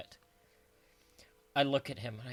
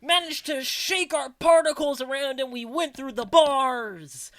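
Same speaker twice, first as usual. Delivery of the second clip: loud dramatic declaration; 7 semitones higher, 9 dB louder than usual. Acted. Declaring spooky jam powers.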